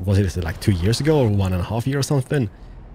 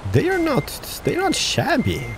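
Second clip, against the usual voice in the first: impressed surprise; 14 semitones higher than usual. Real, not acted. Surprised by truck's looks.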